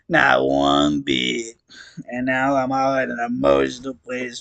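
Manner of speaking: slow, drawn-out clown monologue; stoned clown voice. Acted. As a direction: ramble on like a weepy clown. Gamzee monologue voice.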